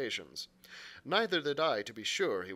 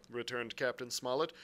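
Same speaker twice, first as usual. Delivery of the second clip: plain reading voice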